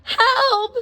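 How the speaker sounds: high, loud cry for help; panicked yell after a fall. A narrator's reading. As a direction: yell help as she falls. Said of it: "she cried".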